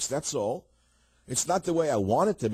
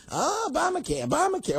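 put-on old lady complaining. Host mocks media as old ladies, high whiny wail. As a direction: wail it like a fussy old woman. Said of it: "I listen to old ladies complaining".

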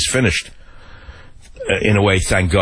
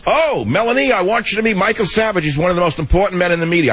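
much higher, fast, gushing Trump introduction; imitating Trump; 9 semitones higher than usual. Trump introducing the radio host to his wife, higher, fast gushing impression. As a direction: play the host greeting his wife and a guest, higher and quicker.

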